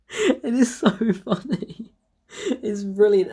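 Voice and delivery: helpless giggles between the phrases; real laughter. Real, not acted. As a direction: tell it while cracking up. Cracking up recounting the fight.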